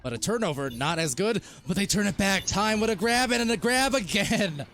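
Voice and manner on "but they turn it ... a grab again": rising sports call; higher-pitched live commentary; excited play-by-play. Acted. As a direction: call the steal with mounting excitement.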